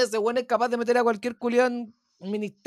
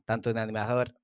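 stoned, slow and losing the thread. Real, not acted. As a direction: drift off mid-sentence, stoned. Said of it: "Estoy muy volado".

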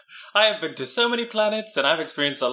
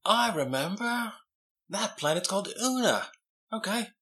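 much softer, hushed recollection; quiet dread; 2 semitones higher, 7 dB quieter than usual. Acted. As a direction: drop your voice remembering a bad place. Player recalls grimly.

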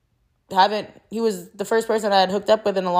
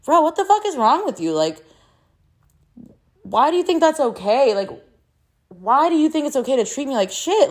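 sharp, raised, exasperated questioning; retold angry confrontation; 6 semitones higher than usual. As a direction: replay how you went off on him. Retold confronting him over text.

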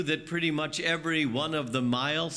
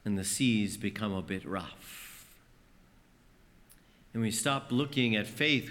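ordinary talk

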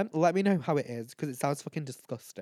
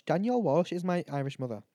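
usual speaking voice

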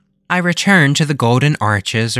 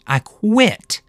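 dramatic hiss; 10 semitones higher than usual. A narrator's reading. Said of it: "I hissed dramatically".

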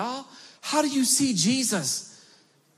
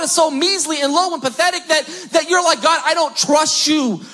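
preaching at full force; 6 semitones higher than usual. Acted. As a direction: drive it home to the congregation. Preaching builds to a shout.

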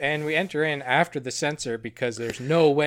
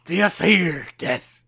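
slow, louder, ominous reaper voice; grim reaper voice; 2 semitones higher, 6 dB louder, slower than usual. Acted. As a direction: draw it out like Death asking. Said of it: "That sounds pretty Grim Reaper".

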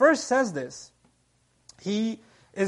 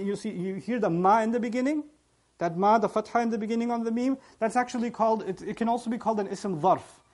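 normal talking voice

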